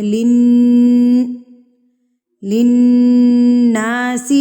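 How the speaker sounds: لن and للناس with the nun held long in the nose, loud; held nasal ghunna. Shown as a demonstration. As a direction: hum the nun through the nose. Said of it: "نون کی آواز کو ناک میں ٹھہرا کر". (للناس غنہ کے ساتھ، ناک میں ٹھہرا کر)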